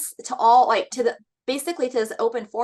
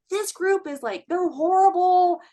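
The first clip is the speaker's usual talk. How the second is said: mock outrage; 8 semitones higher, 4 dB louder, slower than usual. Mocking the mom's social media post.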